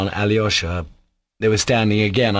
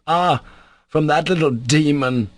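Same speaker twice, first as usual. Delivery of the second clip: higher-pitched scornful exclamation; malicious; 7 semitones higher than usual. A narrator's reading. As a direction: spit out mild malice at the news. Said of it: "He laughed maliciously".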